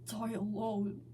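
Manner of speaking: soft, tearful plea; with a cry. A narrator's reading. Broken Sonic crying to Hog, softly and tearfully. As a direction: beg through tears, barely holding on. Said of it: "He would say with a cry".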